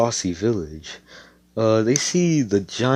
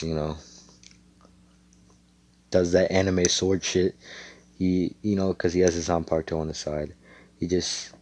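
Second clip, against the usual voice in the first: usual speaking voice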